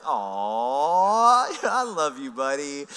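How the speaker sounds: long swooping, gushing aww, then affectionate words; fond aww. Retold or imitated. Retold fond reaction to his son.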